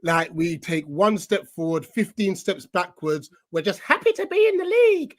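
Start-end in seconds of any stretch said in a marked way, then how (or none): 3.5-5.2 mocking attitude. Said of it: "sort of attitude".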